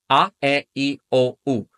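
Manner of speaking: A, e, i, o, u as pure Spanish vowels, each separate; "A, e, i, o, u" in Spanish, one distinct sound apiece. Shown as a demonstration. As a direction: recite "A, e, i, o, u" as ah, eh, ee, oh, oo. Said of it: "Our five vowels in Spanish are".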